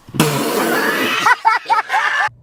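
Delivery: high, loud burst of laughter; loud cackling laugh. Real, not acted. Laughing at herself for encouraging her crush's admirer, high cackle. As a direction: shriek with laughter at yourself.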